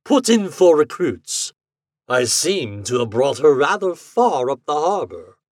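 stately gentleman, unhurried at first; Captain Bartholomew Roberts. A narrator's reading. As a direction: speak as a genteel ship's captain. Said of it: "in a gentleman's voice".

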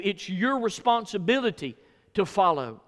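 ordinary delivery

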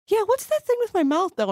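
high, tentative little question; timid. Retold or imitated. Patient asking doctor about swollen mouth, timidly. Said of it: "I actually more timidly went".

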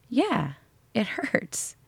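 small, hushed admission of pain; quiet admission. Acted. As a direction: concede it softly, as if to yourself. Answering own doubt mid-workout.

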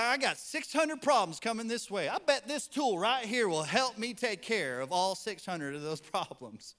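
a mock good-old-boy bragging loudly. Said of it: "That's how they talk".

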